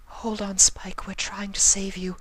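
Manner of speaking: whispered high pleading; high urgent whisper. Acted. Rarity begging Spike to hold on, whispered. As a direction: urge him on in a high whisper.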